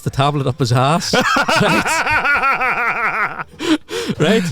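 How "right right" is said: high laughter running into speech; cracking up. Real, not acted. Storyteller reaching the suppository punchline, real high laughter. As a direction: laugh helplessly while checking they follow.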